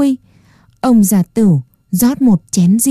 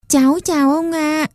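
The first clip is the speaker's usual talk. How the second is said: lightly voiced timid little puppet boy, higher; a shy child greeting an old man; small boy voice; 6 semitones higher, 4 dB quieter than usual. A narrator's reading. Puppet boy greets grumpy old carver, small and timid.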